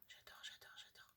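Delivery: breathy whispered "j'adore"; delighted whisper. Real, not acted. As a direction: whisper "j'adore" like a secret thrill. Whispered delight over craft supplies.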